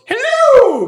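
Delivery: loud shrill greeting; loud high hello. Acted. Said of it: "it goes".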